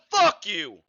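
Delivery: ordinary talk, nothing marked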